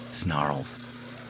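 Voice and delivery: ordinary delivery, nothing marked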